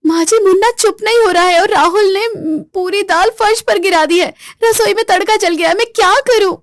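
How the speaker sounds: high, panicked complaint; overwhelmed bahu crying out for help; desperate. Acted. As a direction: at the end of her rope. Kaveri desperate.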